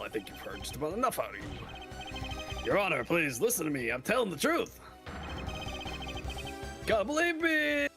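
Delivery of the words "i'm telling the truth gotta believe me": high, frantic plea; desperate pleading. Acted. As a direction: beg the judge to believe you. Defendant pleading with judge.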